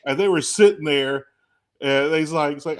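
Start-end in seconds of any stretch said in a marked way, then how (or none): none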